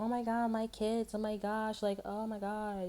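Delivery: high, gushing "oh my God" parent voice; mock a parent raving about their kids. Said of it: "Everybody's so, like, you know".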